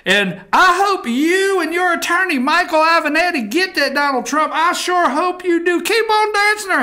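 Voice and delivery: hammed-up rowdy fan pleading in a high voice; high, excited good-old-boy whine; outraged Texas guy. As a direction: holler encouragement at the stage in mock outrage. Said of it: "we found the one guy in Texas that's outraged".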